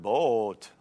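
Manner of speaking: "boat" stretched into a long Wisconsin O; three-syllable "boat". Shown as a demonstration. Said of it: "I could make boat into, like, a three-syllable word".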